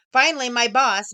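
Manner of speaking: plain reading voice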